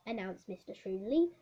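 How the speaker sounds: ordinary delivery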